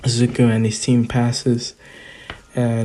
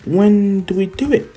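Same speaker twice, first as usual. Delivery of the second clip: high, eager mock girl voice; bubbling with excitement, pitched up; excited; 9 semitones higher, 3 dB louder than usual. Uraraka asking when the date is, excited. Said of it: "She's, like, excited".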